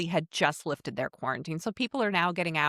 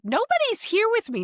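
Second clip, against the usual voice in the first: high, louder upset cry; upset protest; 4 dB louder than usual. Retold or imitated. Telling the host about the biopsy, crying out to the nurses in distress. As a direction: blurt it out in distress. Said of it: "I'm like".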